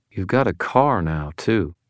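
plain reading voice

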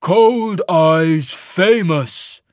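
very slow, loud, booming exclamation; rumbling giant. A narrator's reading. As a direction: boom it out like an avalanche.

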